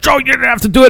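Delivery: high-pitched mock pleading; imitated protest. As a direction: imitate an upset man objecting. Retold plea in a fight.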